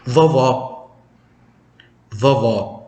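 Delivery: Vovó with a sharp, open final ó; stress on the last O. Shown as a demonstration. Teacher models Vovó meaning grandma, strong final stress. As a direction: hit the final ó of Vovó hard. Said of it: "dengan tekanan yang sangat keras".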